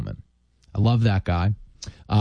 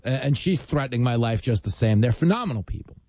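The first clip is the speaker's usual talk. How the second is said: normal talking voice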